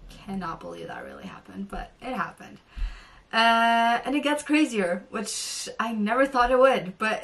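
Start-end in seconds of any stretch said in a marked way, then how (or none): 0.1-2.6 hushed whisper of disbelief; awed whisper. Real, not acted. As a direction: whisper it like you still can't believe it. Disbelief at the star's message.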